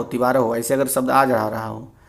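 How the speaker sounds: normal talking voice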